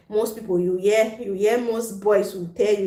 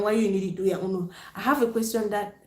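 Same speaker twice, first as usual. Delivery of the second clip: usual speaking voice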